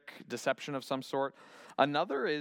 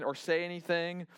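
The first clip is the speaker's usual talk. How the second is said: ordinary talk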